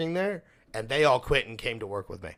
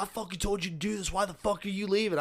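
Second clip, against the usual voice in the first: retold scream; 8 semitones higher than usual. Lead screaming at worker leaving at clock-out, mock yell. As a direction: imitate an irate boss in a raised pitch. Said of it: "he fucking screams at me".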